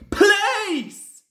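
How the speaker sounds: a full-throated high shout of Police; both men bellowing Police to the signalman. A narrator's reading. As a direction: yell it at the top of your lungs.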